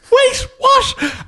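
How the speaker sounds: very high startled shout; shocked disbelief. Retold or imitated. Woken son shouting in high disbelief. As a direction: yelp it half-awake. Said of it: "And I'd be like".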